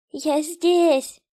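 high, loud call for rescue; distant child's cry. A narrator's reading. Daughter under the floor to her father, high urgent cry. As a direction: call out desperately to be found. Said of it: "Слабый голос донёсся из-под пола".

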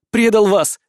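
high, loud tearful cry; shouting. Acted. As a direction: shout the betrayal out. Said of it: "кричит Силати".

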